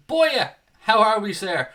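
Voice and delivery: excited greeting. Real, not acted. Excited greeting to a chat viewer.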